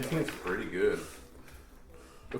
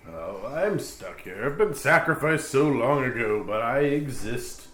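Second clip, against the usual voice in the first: vain ghost voice; 5 semitones higher, 5 dB louder than usual. Acted. Ghost NPC voice.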